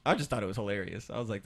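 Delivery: normal talking voice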